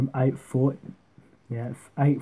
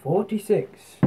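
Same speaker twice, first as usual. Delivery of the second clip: higher darts caller's score call; referee voice; 7 semitones higher, slower than usual. Shown as a demonstration. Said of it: "get our referee voice on".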